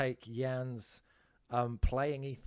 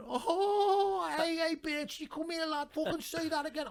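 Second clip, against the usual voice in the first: high mock threatening Scouse shout; hammed-up Scouse aggro, higher; angry Scouse lad; slower than usual. Lad squaring up to the joker, mock Scouse threat. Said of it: "And he went".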